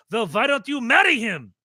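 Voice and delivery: loud, high taunting comeback; mock retort. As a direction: hurl it as a mocking challenge. Retold wrestler's famous insult.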